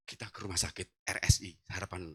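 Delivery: low, soft, halting words; weak sick voice. Retold or imitated. Sick husband asks wife for hospital, weak low voice. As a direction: talk with little breath left. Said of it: "Saya ngomong gini".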